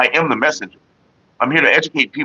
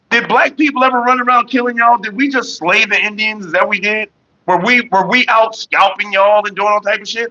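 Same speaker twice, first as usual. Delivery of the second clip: indignant retort; 7 semitones higher than usual. Retold or imitated. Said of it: "I was like".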